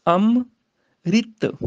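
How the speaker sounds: ਅੰਮ੍ਰਿਤ very slowly, the i on ਰ, am-rit; ਅੰਮ੍ਰਿਤ ਹੌਲੀ ਉਚਾਰਨ; careful pronunciation. Shown as a demonstration. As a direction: draw out ਅੰਮ੍ਰਿਤ to show the ri. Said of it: "ਸਿਆਰੀ ਰਾਰੇ ਨੂੰ ਬੋਲੀ ਜਾਏਗੀ".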